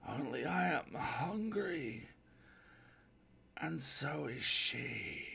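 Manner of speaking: very slow, drawn-out voice from the dark; weary castaway calling faintly over water; mysterious unseen stranger. Acted.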